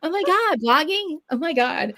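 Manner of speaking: high, gushing mock amazement; hammed-up wide-eyed excitement; retold excitement. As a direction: act out giddy discovery, pitch climbing high. Retold thrill at discovering blogging.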